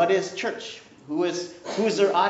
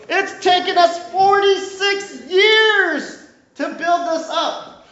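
much higher, louder, slow mocking voice; scoffing leaders; 11 dB louder, slower than usual. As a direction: ham up the indignant temple leaders. Temple leaders scoff at Jesus.

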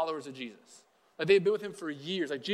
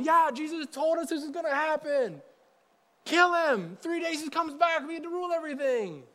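high-pitched, hammed-up excited shout; mock triumphant cheering; 9 semitones higher than usual. Pastor as imagined disciples cheering Jesus on, mock triumphant. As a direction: crow it high like a gung-ho fan.

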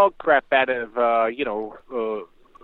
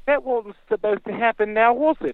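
higher-pitched mock British lady; British accent; 7 semitones higher than usual. Retelling the British woman's reaction at the fight, British accent. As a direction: quote her in a put-on British accent. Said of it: "Ceylon's British accent".

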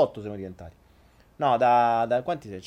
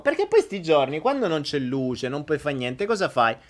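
ordinary talk, nothing marked

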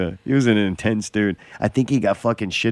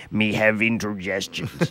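pirate voice; 6 dB quieter, slower than usual. Pretend. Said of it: "Yar, me".

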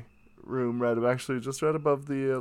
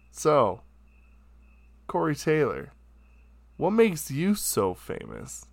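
cocky question; 5 semitones higher than usual. Retold or imitated. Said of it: "Said it just like that".

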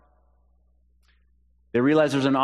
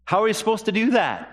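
high, incredulous disciple voice; bewildered disciple; 9 semitones higher than usual. Retold or imitated. Disciples protesting to Jesus, high and bewildered. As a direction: pitch it up, baffled and pleading. It's like.

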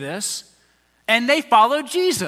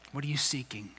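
soft question; 7 semitones lower, 12 dB quieter, faster than usual. Real, not acted.

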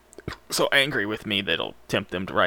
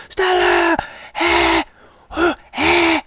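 high strained whisper-scream; 9 dB louder, slower than usual. Shown as a demonstration. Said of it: "Whisper screams".

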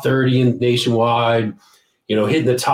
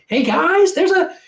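imitated mom's very high-pitched call; shouting down stairs; 3 dB louder than usual. Pessimom calls the band to the phone, imitated high-pitched shout. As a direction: holler it high, like a mom calling the band. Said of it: "Pessimom shouts down the stairs".

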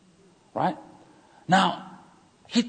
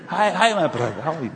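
loud, higher, laughing through the greeting; laughing emphasis; 5 semitones higher, 5 dB louder than usual. Shown as a demonstration. Needling a title-proud pastor, laughing greeting. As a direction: tease him with the word "brother". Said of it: "I'm making that deliberately".

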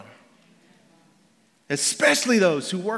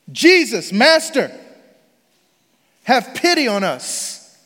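raised, slowing cry for mercy; loud calling out; 3 semitones higher, 5 dB louder, slower than usual. Acted. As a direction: call out to Jesus, pleading across a distance. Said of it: "called out in a loud voice".

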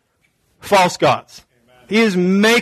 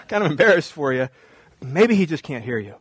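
low-key, feigned embarrassed sympathy; mocking; 5 semitones lower, 4 dB quieter than usual. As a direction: gently pretend to feel bad for them. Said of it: "Just totally mocking them".

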